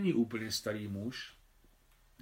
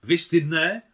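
excited; 5 semitones higher, 12 dB louder, slower than usual. Acted. Claudine still upset about lunch.

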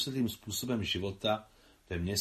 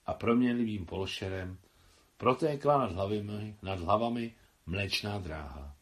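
plain reading voice

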